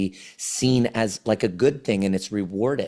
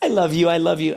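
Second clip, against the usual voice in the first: higher mock-frightened plea; imitating scared child; 8 semitones higher, 4 dB louder, faster than usual. Child turns to his chasing dad, imitated frightened plea. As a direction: play a little kid pleading to calm the monster. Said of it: "he was scared".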